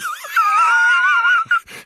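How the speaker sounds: high shrieking laugh, crying with mirth; helpless laughing shriek; spluttering laugh. Acted. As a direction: lose it completely. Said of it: "He spluttered".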